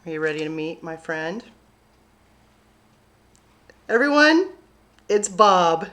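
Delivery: ordinary delivery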